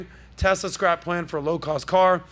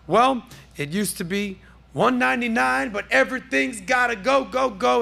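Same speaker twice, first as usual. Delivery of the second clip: higher, hammed-up car salesman blowout pitch; cheesy TV dealer yelling everything must go; pushy sales pitch; 5 semitones higher than usual. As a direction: sell it like a clearance-sale ad. Pitching a Tesla price cut.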